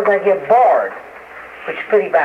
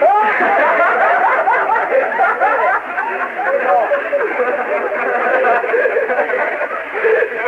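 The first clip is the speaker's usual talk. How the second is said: a big, high, rolling laugh that runs on; long hearty laugh. Real, not acted. Laughing hard while telling interviewers about his name.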